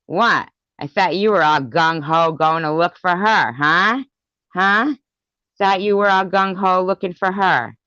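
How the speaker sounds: ordinary delivery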